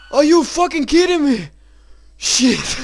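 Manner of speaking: loud high disbelieving yell; furious scared shout. Real, not acted. Player jumpscared again, yells at the game in disbelief.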